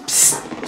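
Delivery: short unvoiced psst hiss; psst at cat. Real, not acted. Owner hissing at the cat clawing the doorpost, sharp psst.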